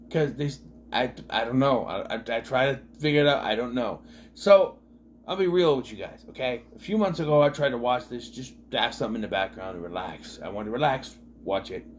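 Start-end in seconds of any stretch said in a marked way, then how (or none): none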